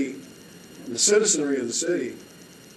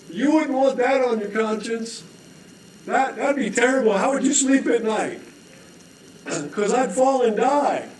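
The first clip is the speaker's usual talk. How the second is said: higher, wheedling voice; guilt-tripping dangler; 8 semitones higher, 3 dB louder than usual. Acted. Dangling man guilt-tripping the holder, higher and wheedling. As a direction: lay the guilt on sweetly.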